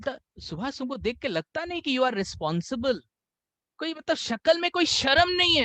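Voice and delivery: high mock reproach; imitating a reproachful boss; scolding boss. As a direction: needle the employee.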